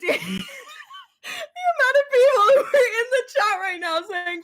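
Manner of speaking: high, slow, squealing laughter; real laughter; laughing. Real, not acted. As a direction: push words through giggles. Still laughing at chat.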